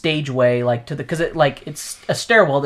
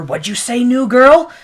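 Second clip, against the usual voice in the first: snappy challenge; 9 semitones higher, 8 dB louder than usual. Retold or imitated. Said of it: "They all look at her".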